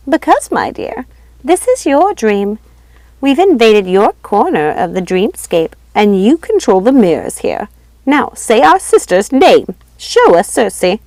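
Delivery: high, loud cackling witch voice; shrill witch trio goading the princess; witch sisters taunting. A narrator's reading. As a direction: loom over her like mocking witches. Odd Sisters voiced taunting Aurora.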